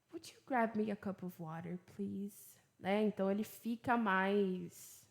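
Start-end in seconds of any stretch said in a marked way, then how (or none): 0.1-2.4 soft, gentle polite ask; softened, courteous request; polite, sweet request. Shown as a demonstration. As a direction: ask nicely and softly, easing off on please. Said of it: "mais educadinho, assim, mais bonitinho".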